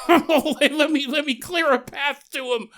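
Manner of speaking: high, loud, laughing through the words; laughing while quoting. Retold or imitated. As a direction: laugh as you play the eager soldier.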